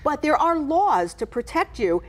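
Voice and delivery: normal talking voice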